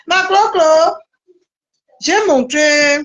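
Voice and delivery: high, drawn-out taunt to a rival; taunting call. Real, not acted. Taunting calls to absent rival. (interpellation moqueuse, voix haute)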